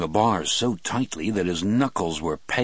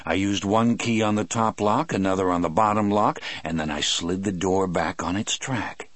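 usual speaking voice

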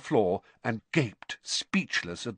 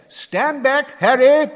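high, loud warning shout; warning call; 10 dB louder, slower than usual. A narrator's reading. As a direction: clear the room, call it out. Warning before blasting fireplace.

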